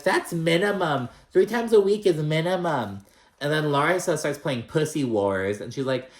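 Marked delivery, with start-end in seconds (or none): 0.0-3.1 higher, louder bragging quote; mock boastful Alexia. Podcaster imitating Alexia bragging, higher and louder. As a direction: brag that it's the bare minimum. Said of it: "Alexia's like".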